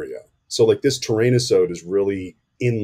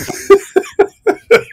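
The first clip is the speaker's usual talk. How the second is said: squealing laugh, much higher; high laugh burst; 9 dB louder than usual. Real, not acted. Cracking up at the weathering joke.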